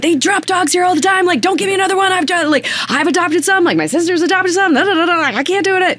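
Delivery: high exasperated locals; exasperated neighbor. Retold or imitated. Neighbors refusing another stray, high and exasperated. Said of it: "they're like".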